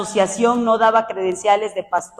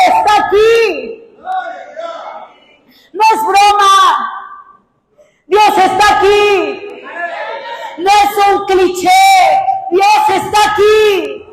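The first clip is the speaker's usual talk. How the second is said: loud, high, slow proclamation of God's presence; impassioned preaching; 8 semitones higher, 6 dB louder than usual. Real, not acted. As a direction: proclaim it slowly with full force. (proclamación fervorosa, lenta y a gran voz)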